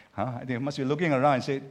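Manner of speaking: usual speaking voice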